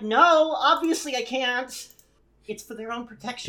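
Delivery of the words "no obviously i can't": exasperated loud quote. Retold or imitated. And then I'm like.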